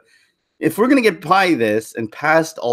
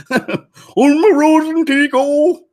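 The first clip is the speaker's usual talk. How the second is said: high, slow, hammed-up mock Swedish Chef babble; goofy fake Swedish accent, stretched out slow and high; 13 semitones higher, 5 dB louder, much slower than usual. Mocking a Swedish accent while joking on Swedes, high slow mock Swedish Chef. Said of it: "that accent from the Swedish Chef".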